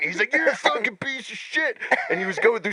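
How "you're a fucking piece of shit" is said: high mock insult; mimicked erratic drunk. Maced cellmate turning on the narrator, mimicked erratic insult. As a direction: hurl it in a high, put-on voice. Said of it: "he was on some type of drug".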